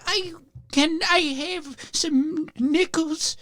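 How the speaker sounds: put-on high, very slow old lady; very old woman. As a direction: ask like a frail old lady. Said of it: "she would be like".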